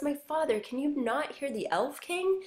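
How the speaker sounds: high-pitched, lightly acted insistent plea. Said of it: "So he's getting more insistent".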